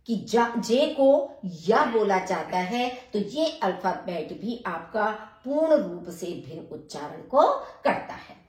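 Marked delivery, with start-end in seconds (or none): none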